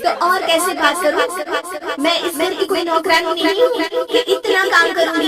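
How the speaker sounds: high, loud, defiant outburst; angry retort. Acted. Bride answering her mother-in-law back, angry and loud. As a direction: snap back, offended and proud.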